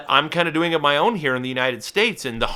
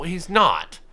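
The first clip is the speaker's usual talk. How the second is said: ordinary talk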